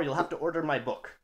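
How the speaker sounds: ordinary delivery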